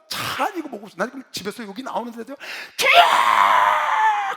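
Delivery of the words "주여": long, high, full-force cry; prayer shout. Retold or imitated. Preacher showing how he cried to the Lord leaving home, drawn-out shout. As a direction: bellow it out, holding the vowel. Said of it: "그러는 외치고 나왔습니다".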